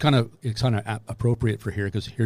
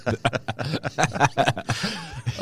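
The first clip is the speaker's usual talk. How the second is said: real laughter; 4 semitones higher than usual. Real, not acted. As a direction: crack up at a friend's joke. Listener cracks up at self-mocking joke.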